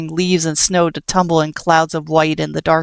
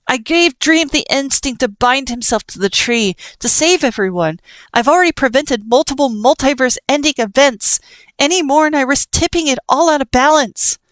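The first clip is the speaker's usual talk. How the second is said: raised defensive voice; 8 semitones higher, 3 dB louder than usual. Acted. Frisk keeps shouting, defending himself.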